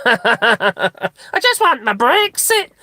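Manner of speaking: high, slow, whiny plea; mock begging Brexit voice. Mimicking a street interviewee begging for Brexit. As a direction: beg like a hammed-up politician. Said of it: "That's how they go, isn't it?".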